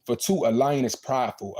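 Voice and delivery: normal talking voice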